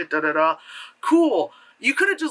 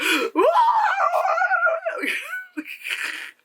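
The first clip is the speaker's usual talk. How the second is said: long, high hammed-up shriek; mock screaming wail. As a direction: shriek on and on like an overacting actress. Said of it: "Or she's like".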